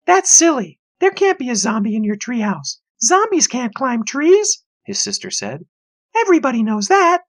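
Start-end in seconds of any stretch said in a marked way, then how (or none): 0.1-4.6 scoffing sister. A narrator's reading. 6.2-7.2 know-it-all sister. A narrator's reading. Sister scoffs.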